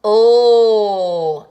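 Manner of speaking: long held L, tongue up; the L sound. Shown as a demonstration. Teacher sounding out letter L for children. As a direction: hold the L out loud and steady. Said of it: "lowercase l".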